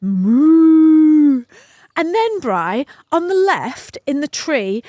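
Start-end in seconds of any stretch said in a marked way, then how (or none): 0.0-1.4 loud, high mock moo; cow moo. Telling Bri about the cows, a loud mock moo. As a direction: belt out a cow's moo like a pasture cow. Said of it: "it goes".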